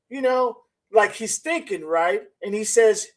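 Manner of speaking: normal talking voice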